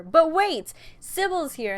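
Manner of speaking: a loud, high "But wait!" like a trailer twist; dramatic exclamation. Acted. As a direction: cut in with a sudden rescue. Dramatic turn in retelling.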